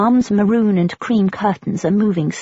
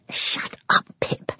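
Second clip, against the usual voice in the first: inner voice whisper; 6 dB quieter, slower than usual. A narrator's reading. Inner voice scolding herself.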